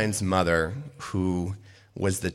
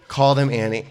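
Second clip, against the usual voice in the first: ordinary talk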